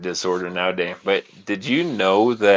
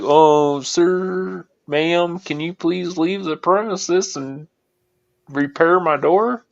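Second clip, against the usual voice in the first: high, drawn-out pretend politeness; mock polite plea; 7 semitones higher, slower than usual. Homeowner mockingly pleading with door-kicking intruders, mock polite plea. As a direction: put on a meek, higher-pitched voice of polite request. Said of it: "I'm not gonna be standing there like".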